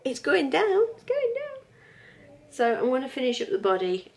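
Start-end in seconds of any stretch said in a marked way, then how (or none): 0.0-0.8 higher. Pretend. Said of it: "repeated at higher pitch". 0.9-1.6 much higher, playful It's going down; squeaky repeat. Pretend. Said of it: "repeated even higher".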